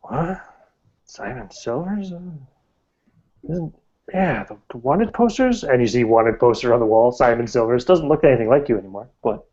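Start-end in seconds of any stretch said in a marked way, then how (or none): 0.0-5.6 high startled disbelief; surprised. Acted. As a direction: gasp it out, caught off guard. Local recognizes wanted man.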